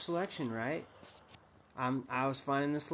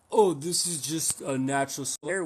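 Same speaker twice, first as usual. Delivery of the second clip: mocking a raw vegan, a bit louder; mock vegan excuse; 5 dB louder than usual. Streamer mocking the raw vegan in chat, mock voice. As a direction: parody his smug shrug-off.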